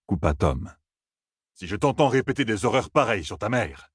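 a hint of a stern old bard; stern Tom scolding. Tom scolding drunk Elaine, lightly voiced sternness. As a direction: cut her off and scold.

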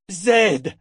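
loud, high call of the name. A narrator's reading. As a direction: call out to him in alarm. Richard calls the frozen wizard.

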